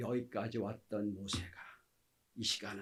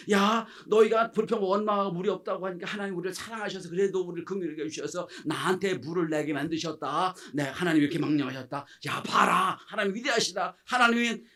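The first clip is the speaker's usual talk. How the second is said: loud, raised-pitch declaration to the crowd; emphatic public testimony; Moses proclaiming rightly; 4 semitones higher, 7 dB louder than usual. Acted. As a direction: proclaim God's greatness with rising force. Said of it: "이렇게 해야죠".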